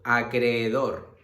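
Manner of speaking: acreedor said slowly and carefully; drawing out acreedor so students hear both e's; slow example word. Shown as a demonstration. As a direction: acreedor stretched out for the class. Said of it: "Eh, por ejemplo".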